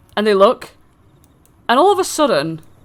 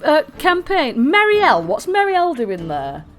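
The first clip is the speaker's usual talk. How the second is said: slow, showy game show announcing; announcer style; slower than usual. Acted. Game master hyping initiative order for the players, announcer voice. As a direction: announce each roll like a host.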